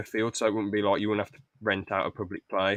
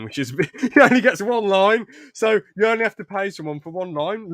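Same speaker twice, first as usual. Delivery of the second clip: higher, louder, breaking into a laugh; amused; 9 semitones higher, 7 dB louder than usual. Real, not acted. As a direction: talk through rising laughter. Amused by own script.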